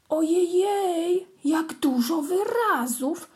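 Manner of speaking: 깜짝 놀란 고양이; high, slow amazed exclamation. A narrator's reading. Kitten amazed.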